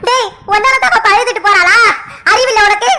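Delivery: indignant mother's rapid-fire rebuke; angry scolding. Acted. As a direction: tear into your son for his mistake. Mother scolding son after his confession.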